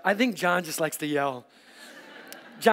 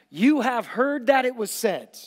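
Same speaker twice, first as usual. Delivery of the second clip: loud, slow and higher proclamation to the crowd; Jesus proclaiming; 5 semitones higher, 5 dB louder, slower than usual. Acted. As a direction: boom it out slowly like Jesus to the crowd. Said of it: "He looks out over this vast crowd and he goes".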